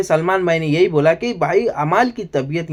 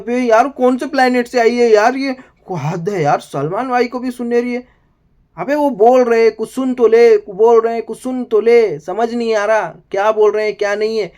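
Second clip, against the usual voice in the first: mock exasperated Salman; 7 semitones higher than usual.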